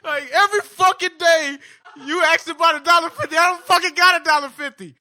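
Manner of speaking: loud, high furious rant; imitating an angry father shouting; dad yelling. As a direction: yell it like an exhausted dad who snapped. Dad yelling at kid in story.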